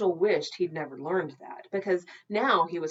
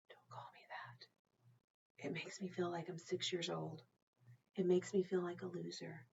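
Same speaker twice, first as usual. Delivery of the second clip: hurt whisper; 9 dB quieter than usual. Acted. Virgil quietly asking his mom to drop the nickname, whispering. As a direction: murmur it, embarrassed.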